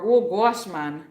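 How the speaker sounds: Trini exclamation, loud then dropping; Trinidadian accent. Shown as a demonstration. Streamer showing viewers her Trini phrases, Trinidadian accent. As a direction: punch the opening in a Trini lilt. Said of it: "insert in my Trini accent".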